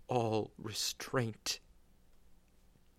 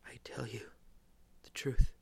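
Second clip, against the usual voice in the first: a dying man's strained promise; through clenched teeth. Acted. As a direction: force each word out through the pain. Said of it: "with clenched teeth".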